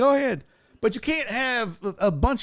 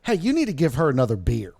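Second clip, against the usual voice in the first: lower, matter-of-fact mom voice; mom's deadpan quip; 7 semitones lower, faster than usual. Retold or imitated. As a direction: say it dryly as the calm mom. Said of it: "turns to the kid and goes".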